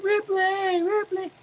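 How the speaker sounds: put-on high, urgent call of a scared child; calling out for Ripley. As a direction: cry out her name from far away. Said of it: "and he goes".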